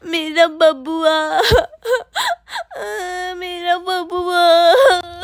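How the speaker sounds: slow wailing sobs around the words; mother wailing for her missing son; crying. Acted. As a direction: sob it out, dragging each word. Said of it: "Nirmala के रोने की आवाज".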